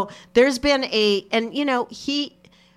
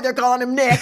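usual speaking voice

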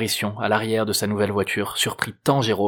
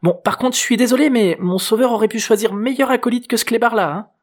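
put-on high, loud teenage girl's voice; much higher, mock-apologetic teenager; cheeky teen girl; 12 semitones higher, 5 dB louder than usual. A narrator's reading. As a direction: tease your rescuer as a cheeky girl. Narrator plays Juliette teasing.

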